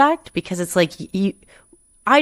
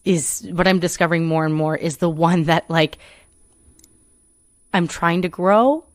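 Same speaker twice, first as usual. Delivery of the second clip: ordinary talk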